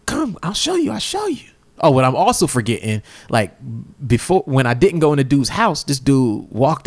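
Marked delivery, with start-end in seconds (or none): 0.1-1.5 high-pitched imitated urging; high, comic urgency; mock frantic coaxing. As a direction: imitate an insistent man beckoning someone along. Retells naked man urging neighbor.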